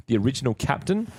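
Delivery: ordinary talk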